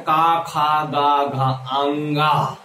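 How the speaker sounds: loud-ish drawn-out long aa on "का, खा, गा, घा"; mimicked wrong recitation. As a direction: imitate a pupil reciting "अंगा" as two full open syllables. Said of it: "तो बोलते हैं".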